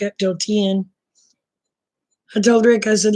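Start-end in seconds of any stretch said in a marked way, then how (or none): none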